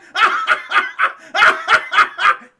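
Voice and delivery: very loud, high villain cackle; Vizzini. Retold or imitated. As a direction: maniacal hammed-up laughing fit. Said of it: "I could make a good Vizzini".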